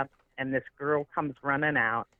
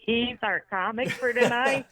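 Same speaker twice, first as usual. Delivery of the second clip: high-pitched, incredulous mock outburst; amused disbelief; 8 semitones higher than usual. Bartender to executive director about tonight's comic, amused and incredulous. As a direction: act out his delighted disbelief, pitched up. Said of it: "And he's laughing".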